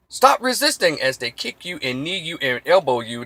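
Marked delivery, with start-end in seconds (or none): 0.1-1.0 high, loud barked order; mock cop yell. Cop kicking a man on the ground, high loud yell. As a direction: bark it like a cop in a beating. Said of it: "The old script was".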